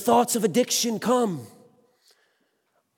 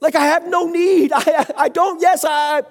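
higher and louder, laughing at himself; fired up; 5 semitones higher, 3 dB louder than usual. Real, not acted. Preacher telling the church his needs are met, excited and loud. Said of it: "I'm fired up about this".

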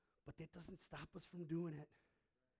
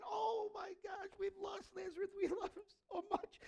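mock mourning wail; 5 dB louder than usual. Mourners weeping for Lazarus, a high mock wail. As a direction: cry out as grieving friends. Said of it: "everyone's crying".

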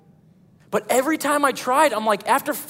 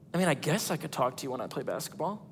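much softer, lower, quick and reluctant; hesitant low mumble; 8 semitones lower, 11 dB quieter, faster than usual. Retold or imitated. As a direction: concede it under your breath. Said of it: "I'm like".

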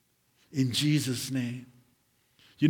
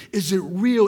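loud challenge; 5 semitones higher, 9 dB louder than usual. Acted. Preacher challenging the congregation about real care, loud and pressing. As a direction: call it out, higher and louder. Repeated questions, loud then hushed.

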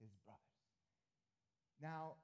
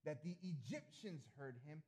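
"So loudly" stretched slow and louder; dramatic scripture reading; 2 semitones higher, 4 dB louder, slower than usual. Acted. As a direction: read the verse out with weight.

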